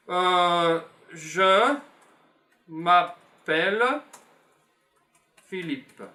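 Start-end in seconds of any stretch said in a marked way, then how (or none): none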